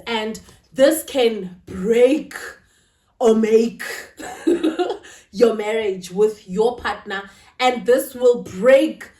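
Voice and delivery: slow, loud, heavy stress on "break" and "make". Real, not acted. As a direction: drive home "break or make", slowed right down. Stressing how discipline breaks marriages.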